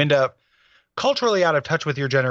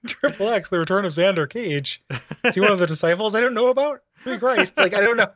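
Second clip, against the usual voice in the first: dumb guy voice; 5 semitones higher than usual. Pretend. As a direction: play the dad as a dopey guy. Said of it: "giving your dad the dumb guy voice".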